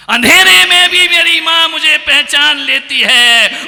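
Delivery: high, loud, sustained declamation; impassioned preaching cry. Shown as a demonstration. Preacher repeats his praise of mother, crying out at full force. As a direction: belt it out to the whole crowd.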